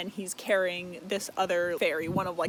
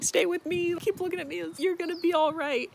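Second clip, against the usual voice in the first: much higher, pleading voice; desperate pleading; 9 semitones higher, faster than usual. Retold or imitated. Feyre begging the dying fairy to hold on, high and pleading. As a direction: beg him to stay awake, pitched high.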